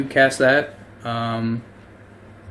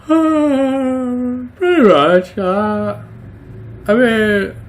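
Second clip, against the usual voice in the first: high goofy dog voice, slow; a cheerful simple dog answering; 8 semitones higher, slower than usual. Acted.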